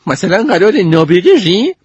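put-on high, sarcastic girl voice. Goldie mocks Sneeze as leader, high and sarcastic. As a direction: sneer the question.

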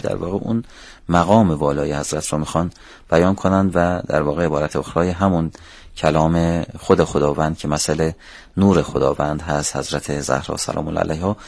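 ordinary delivery

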